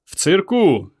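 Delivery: high shout. A narrator's reading. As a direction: shout it over the crowd. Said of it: "крикнув Петро".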